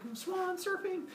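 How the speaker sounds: high, light little squeal; thin high-pitched joyride voice; playful glee. Acted. Woman riding on the swan's back, gleeful. Acting her ride on the swan.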